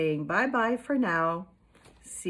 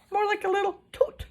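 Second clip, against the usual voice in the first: little toot; 12 semitones higher, 4 dB louder, faster than usual. Acted. Smaller fire puff voiced.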